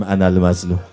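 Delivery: usual speaking voice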